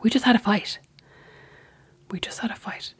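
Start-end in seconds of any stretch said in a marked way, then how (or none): 2.1-2.9 much softer, breathy whispered echo; soft whispered repeat. Retold or imitated. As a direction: mutter it under your breath, mocking. Whispered echo of his words.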